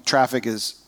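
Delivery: dry, understated sarcasm; sarcastic aside about family traffic. Real, not acted. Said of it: "He said sarcastically".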